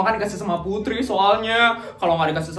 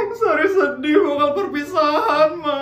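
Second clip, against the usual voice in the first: high, louder sobbing wail; crying; 8 semitones higher, 3 dB louder than usual. Acted. Daughter tells mother she's sad about farewells, sobbing. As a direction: sob it out like a crybaby kid.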